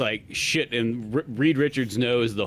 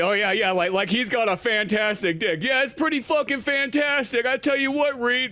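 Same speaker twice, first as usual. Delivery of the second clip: much higher taunting voice; mocking Sue; 11 semitones higher than usual. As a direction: taunt your husband in a high mocking voice. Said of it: "You know, I mean".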